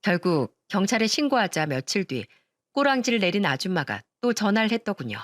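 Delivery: usual speaking voice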